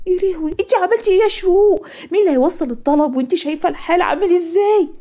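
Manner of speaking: high-pitched, aghast mother's outcry; alarmed scolding. Acted. Mother confronting her daughter over the accepted order, alarmed. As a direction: scold in rising panic.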